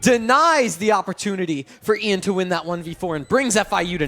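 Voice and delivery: rising hype caster call; esports caster. Acted. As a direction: build from calm to shouting. Play-by-play build to clutch.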